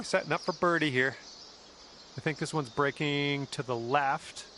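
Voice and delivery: calm golf broadcast voice; golf commentator. Acted. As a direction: call the shots like TV golf. Golf game commentary throughout.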